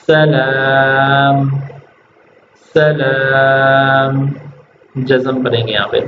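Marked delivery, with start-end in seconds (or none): 0.1-4.3 سلام very slowly, the م held with jazm; pausal form. Shown as a demonstration. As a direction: stop on سلام with a silent م. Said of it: "ٹھہرنا ہو تو". (سلام، م پر جزم)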